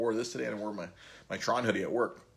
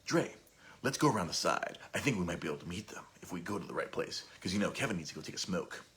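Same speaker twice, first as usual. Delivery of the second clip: hushed, lower impression of his buddy; Sean; 3 semitones lower, 6 dB quieter than usual. Buddy plotting to meet Kevin outside, imitated Sean voice. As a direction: imitate a friend quietly sharing a plan. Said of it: "do it with my Sean voice".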